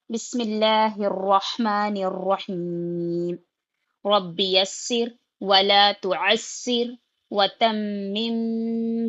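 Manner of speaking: slow, chanted Arabic recitation; measured devotional recitation; recited prayer. Acted. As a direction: intone the blessing slowly and reverently. Recites opening prayer slowly.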